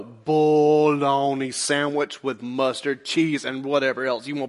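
slow, scornful elongation of "Bologna"; drawn-out dismissal. Real, not acted. Preacher dismissing a lame excuse, scornfully drawn out. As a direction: stretch "Bologna" out very long and slow.

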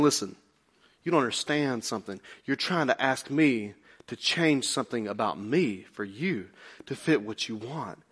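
low, hushed, patient correction; God answering. Retold or imitated. God answering a praying believer, low and firm. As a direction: drop low and soft, speaking as God. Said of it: "And God's saying".

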